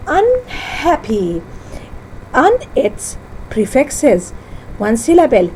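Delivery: ordinary delivery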